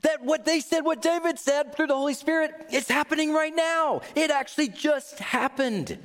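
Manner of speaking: high, ringing, fired-up proclamation; bursting with excitement, slowing to land the last two lines. Retold or imitated. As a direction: shout it like the news just broke. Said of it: "no, they're fired up".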